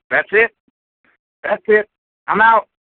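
real exasperation. Real, not acted. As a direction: throw in the towel, voice jumping up. Caller gives up on his team.

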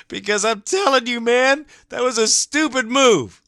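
high, loud, real yell; furious shout. Real, not acted. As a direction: let it burst out at the top of your voice. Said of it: "Sorry, I get emotional".

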